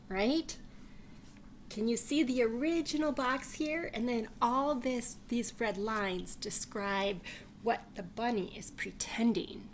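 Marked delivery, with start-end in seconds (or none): none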